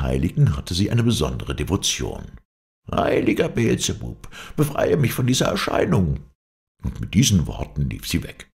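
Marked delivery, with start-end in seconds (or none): 2.9-6.2 high-pitched frightened crone; old witch's plea. A narrator's reading. Witch begs deliverance from the apparition, high-pitched plea. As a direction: plead in a hag's raised voice. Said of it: "rief sie".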